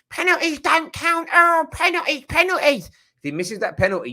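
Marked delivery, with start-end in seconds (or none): 0.1-2.9 high, whiny mocking voice of a rival fan. Mocking rival fans who dismiss penalties, in a high whiny voice. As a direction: sneer it out in a high nasal whine as a rival fan would. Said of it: "imagine this".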